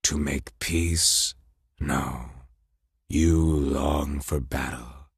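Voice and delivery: slow, low, taunting voice; cold frost king. Acted. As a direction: draw out each taunt slowly. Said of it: "Laufey asked rhetorically".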